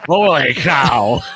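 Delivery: loud, drawn-out sportscaster catchphrase; Harry Carey. Retold or imitated. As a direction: belt it out high and slow. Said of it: "in the voice of Harry Carey".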